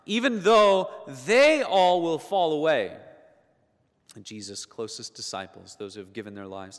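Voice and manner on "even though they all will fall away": higher, louder, slow self-assured vow; Peter's bold boast. Retold or imitated. Preacher voicing Peter boasting to Jesus, confident vow. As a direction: proudly promise you'll never fall. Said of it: "Peter said to him".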